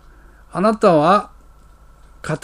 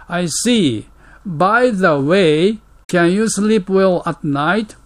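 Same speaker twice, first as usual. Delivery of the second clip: slow model reading; slower than usual. Shown as a demonstration. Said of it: "English model dialogue read slowly".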